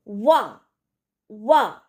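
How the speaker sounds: वा with a crisp rounded w; a clear वा opening; clear waw. Shown as a demonstration. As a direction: say वा cleanly and firmly. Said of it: "साफ आवाज़ में".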